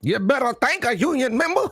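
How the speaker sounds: high, exaggerated parody of the speech accent; mock imitation of the union speech; mocking Kamala's accent. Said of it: "listen to this accent".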